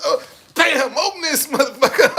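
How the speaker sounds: high, loud laughing shout through the words; laughing yell at a locked door. Retold or imitated. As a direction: howl it out while cracking up. Acting kicking the door.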